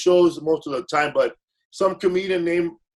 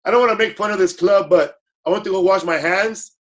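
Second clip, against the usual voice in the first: ordinary talk, nothing marked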